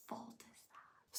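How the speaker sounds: slow unvoiced whisper; whispered dig. Real, not acted. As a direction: tease her under your breath. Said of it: "narrator's whispered dig".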